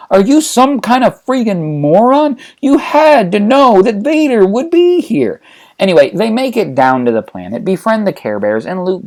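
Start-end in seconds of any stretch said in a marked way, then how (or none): 0.1-5.4 high-pitched acted outrage; exasperated. Smuggler berating his friend on the mission, high exasperation. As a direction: scold him high and incredulous, stretching key words. Han berates Luke for coming.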